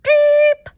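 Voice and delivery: high, loud bird chirp; squeaky blackbird peep. A narrator's reading. Boy secretly chirping during silent reading, high squeaky peep. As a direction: let out a shrill blackbird peep. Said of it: "let out a high, squeaky blackbird peep".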